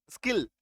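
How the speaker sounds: स्किल with a short "ih". Shown as a demonstration. Teacher answering how it is pronounced, स्किल with short i. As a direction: say स्किल, vowel as in "sit". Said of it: "उच्चारण क्या हो जाएगा".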